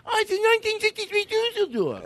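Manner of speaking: high nasal car-buff voice; gushing car collector voice; Jay Leno impression. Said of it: "Jay Leno would say".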